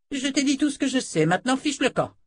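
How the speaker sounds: high-pitched, exasperated outburst; exasperated dismissal. A narrator's reading. Sorceress ends the talk with Jensen, exasperated.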